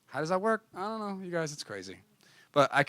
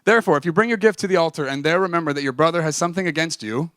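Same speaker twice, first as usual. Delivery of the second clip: scripture reading; 3 semitones higher, 8 dB louder than usual. Acted. Reads Bible verse.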